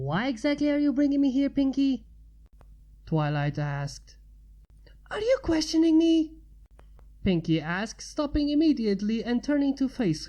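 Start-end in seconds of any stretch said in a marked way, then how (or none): 0.0-2.1 Twilight voice. A narrator's reading. 5.1-6.3 Pinky voice. A narrator's reading.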